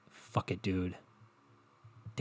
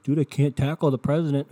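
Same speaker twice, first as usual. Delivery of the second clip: louder, higher quip as the doctor; mock doctor; 5 semitones higher, 6 dB louder than usual. Retelling the popular doctor, lightly imitating him louder. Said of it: "he's popular now 'cause he was like".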